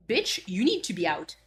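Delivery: ordinary delivery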